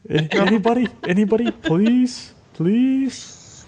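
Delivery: hammed-up high begging please; mock pleading. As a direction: plead into the void like a lonely soul. Jokingly begging listeners to respond.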